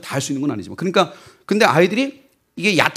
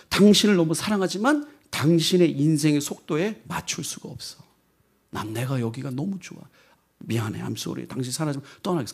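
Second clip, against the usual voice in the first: much softer, hushed apology; tender goodbye; 4 dB quieter than usual. Retold or imitated. Samantha softly apologizes to Tim as she leaves. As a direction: say sorry gently, then let go. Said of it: "사만다가 뭐라 하냐면".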